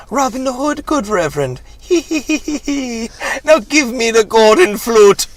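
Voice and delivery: high cackling leprechaun; squeaky impish high voice. Acted. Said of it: "Oh, this is a leprechaun".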